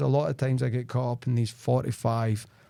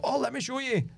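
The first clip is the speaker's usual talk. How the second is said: much higher, chirpy eager seller; eager salesperson; 12 semitones higher than usual. Retold or imitated. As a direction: play the keen, untrained salesman. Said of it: "An untrained salesperson would go".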